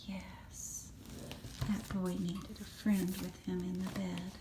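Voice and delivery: very soft breathy agreement; hushed tender aside; whispered aside. Real, not acted. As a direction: whisper it close.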